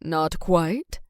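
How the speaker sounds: a gentle fatherly refusal; dad voice. A narrator's reading. Dad answers softly.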